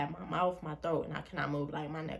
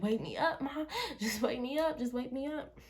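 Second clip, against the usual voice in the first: higher, giggling "wake me up, Mom"; laughing plea; 8 semitones higher than usual. Retold or imitated. Reenacting calling to mom while paralyzed, laughing and higher. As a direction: beg through laughter, pitch up. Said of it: "But I'm like".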